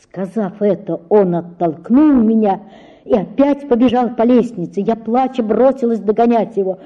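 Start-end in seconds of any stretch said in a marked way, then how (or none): none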